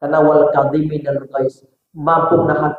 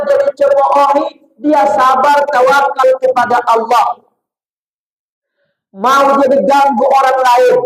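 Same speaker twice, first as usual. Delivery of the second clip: higher, insistent sermon voice; fervent preaching; 8 semitones higher than usual. Acted. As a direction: press each example home. Extended exhortation on patience. (ceramah penuh semangat)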